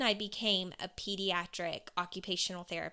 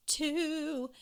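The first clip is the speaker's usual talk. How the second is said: tearful mutter; 8 semitones higher than usual. Retold or imitated. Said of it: "muttering the words".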